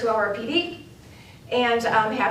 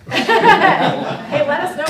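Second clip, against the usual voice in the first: laughing; 8 semitones higher, 10 dB louder, much slower than usual. Real, not acted. Joking about chaperoning the trip.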